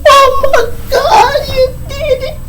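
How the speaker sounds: very high, drawn-out mock crying; anguished wail. Student losing his patrol belt, hammed-up anguished wail. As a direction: squeal it slowly like a hammed-up sob. Wailing as badge is snatched.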